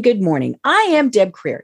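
ordinary talk

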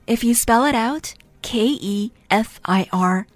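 usual speaking voice

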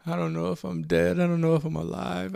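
loud, anguished uncertainty; desperate outburst. Acted. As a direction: burst out in desperation. Desperate climax of monologue.

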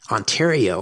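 ordinary talk, nothing marked

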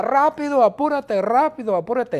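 high, loud hurry-up cries; high-pitched pestering shout; impatient shouting. Acted. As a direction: chant it urgently like a whole impatient family. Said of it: "Todo el mundo grita".

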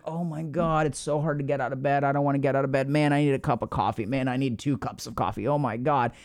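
low, drowsy, dragging complaint, a touch sleepy; groggy mock whine. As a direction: moan it like you can't leave the bed. Groggy inner voice, hard morning.

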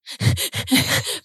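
breathy high nervous laugh; a laugh that is half panic; panicked laugh. Retold or imitated. Laughing nervously while telling a friend about panicking.

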